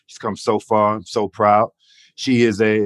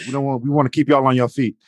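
ordinary talk

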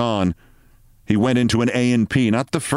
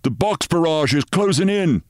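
higher urgent soldier's report; worried sergeant; 8 semitones higher than usual. Acted. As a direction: report it tensely to the captain. Sergeant voiced in story.

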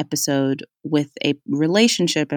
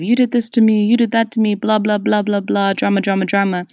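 mock finger pointing; 5 semitones higher than usual. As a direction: rattle off the accusations like a dismissive parody. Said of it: "finger pointing of".

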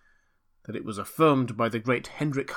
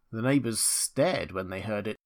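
usual speaking voice

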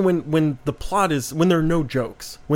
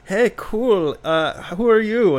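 higher, slow odd Wiseau voice; Tommy Wiseau impression; 7 semitones higher, 3 dB louder, much slower than usual. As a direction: play the strange Wiseau greeting. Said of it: "Tommy Wiseau walks up and is like".

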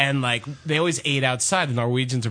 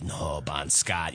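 Fruta taunting; 11 semitones lower, 7 dB quieter, much slower than usual. Retold or imitated. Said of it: "He'd be like".

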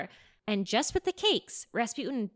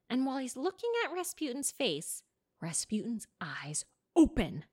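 ordinary delivery

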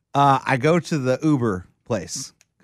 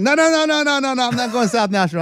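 higher, rapid no no no; refusing cab driver; 9 semitones higher, faster than usual. Retold or imitated. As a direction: brush him off like a stubborn cabbie. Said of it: "He's like".

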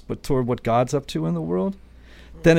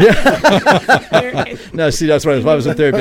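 loud laughing reply; big belly laugh into the words; 2 semitones higher, 8 dB louder than usual. Real, not acted. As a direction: laugh it out loud, then speak through it. Laughing after the sevens remark.

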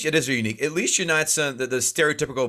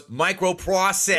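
bad Boston accent, drawn out and higher; Martin Sheen; 5 semitones higher than usual. Quoting a film's terrible accent to cohost, exaggerated Boston accent. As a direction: stretch "Microprocessors" slowly in a mock Boston accent. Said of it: "When Martin Sheen goes".

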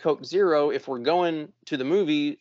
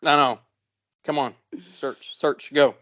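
ordinary delivery

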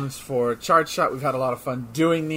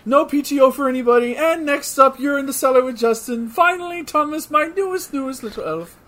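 high, bossy Santa voice; bossy Iggy Claus; 11 semitones higher, 6 dB louder than usual. Acted. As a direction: boss the elves around like Santa. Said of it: "Iggy Claus".

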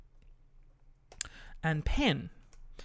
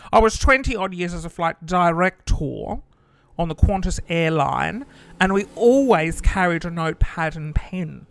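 put-on old dad voice, louder; dad's voice; 9 dB louder than usual. Imitating dad explaining his notepad, put-on voice. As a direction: play your dad, fumbling his words. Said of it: "I'll do it in his voice".

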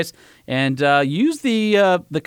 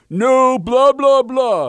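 mocking quote; 10 semitones higher, 5 dB louder, much slower than usual. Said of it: "And you're like".

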